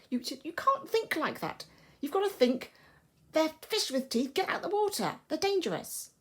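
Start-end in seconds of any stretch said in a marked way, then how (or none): 3.3-6.1 high-pitched urgent warning; panicky warning. Acted. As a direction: warn them in a panic, voice rising. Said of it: "Con is quite panicky still".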